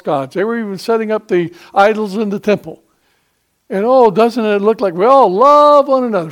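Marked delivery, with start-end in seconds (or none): 3.7-6.3 loud, higher mock-innocent question; sarcastic preaching. Real, not acted. Preacher mocks churches letting in false gods, loud and sarcastic. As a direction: scorn the show of false unity. Mocking churches that tolerate idols.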